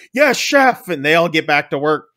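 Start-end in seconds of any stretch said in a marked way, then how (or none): 0.1-0.9 high retold kitchen shout; mock crew yell. Kitchen staff answer their chef, mock crew yell. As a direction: imitate a brigade answering in a yell. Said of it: "everybody yells".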